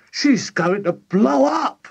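high, loud, slow wail; drawn-out character alarm. A narrator's reading. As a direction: wail it in high-pitched panic. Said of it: "Missus Cranky wailed".

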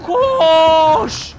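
loud urgent shouts; yelling. Real, not acted. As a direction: shout it, panicking to run. Game chase, yelling run. (bağırarak)

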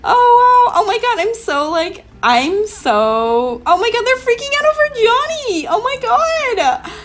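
high, loud excited squeal; squealing fan excitement. Pretend. Reacting to fans mobbing Johnny, high excited squeal.

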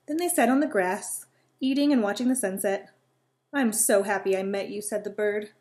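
lively read-aloud voice with little character voices; storytelling. A narrator's reading. Reading a squirrel and bird story to children, lively. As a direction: tell a bedtime story to young listeners.

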